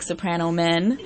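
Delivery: ordinary delivery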